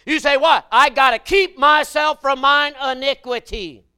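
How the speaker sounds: loud, high preacher's holler; full-force preaching. Acted. Preacher warning the congregation about temptation, hollering. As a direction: drive it home to the pews.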